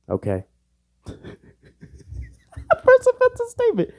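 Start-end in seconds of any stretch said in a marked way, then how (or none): 3.1-3.9 mock squeak. Labels his own remark as offensive.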